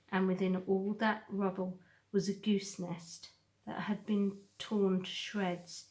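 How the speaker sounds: plain reading voice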